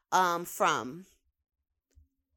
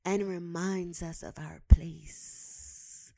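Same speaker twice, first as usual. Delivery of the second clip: slow whisper; 5 semitones lower, much slower than usual. Shown as a demonstration. To listeners, repeating the line as a slow whisper. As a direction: whisper it slowly, low and unvoiced. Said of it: "repeats it whispered".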